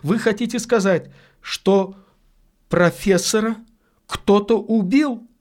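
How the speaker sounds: high, tense, pointed question; sharp questioning. A narrator's reading. Lady asking if the professor was murdered, sharply. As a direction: press the question, pitch held high. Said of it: "резко спросила она".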